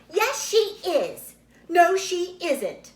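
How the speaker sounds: loud, high and slow squabble; childish bickering. A narrator's reading. Two girls squabbling over babies, loud high bickering. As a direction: trade it back and forth.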